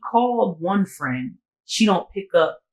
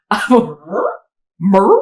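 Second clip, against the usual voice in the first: stunned disbelief; 3 semitones higher, 9 dB louder than usual. Retold or imitated. Stunned reply to slur.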